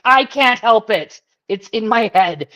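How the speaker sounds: slow, loud, drawn-out complaint; loud and slow, pleading she can't stop; telling her daughter, loud and slow, that the song won't leave; loud whiny protest. Retold or imitated. Her own reply to daughter, acted.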